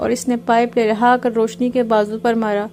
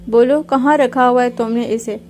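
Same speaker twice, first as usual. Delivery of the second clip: plain reading voice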